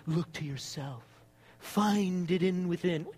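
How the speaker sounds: low, slow guru voice; lightly voiced new age sage; mock new age guru. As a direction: drop low and stretch it out, parodying self-help advice. Mimicking new age advice.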